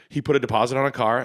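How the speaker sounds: ordinary talk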